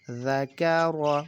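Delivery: "ذا کا را" slowly blended, each vowel drawn out; slow teaching read-out of "ذا کا را" as linked sounds; joined syllables. Shown as a demonstration. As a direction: join "ذا کا را" into one slow, measured word. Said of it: "جب ہم ان کو join کریں گے".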